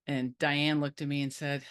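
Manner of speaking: ordinary delivery, nothing marked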